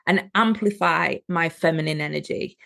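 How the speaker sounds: ordinary delivery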